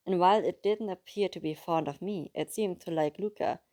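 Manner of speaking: plain reading voice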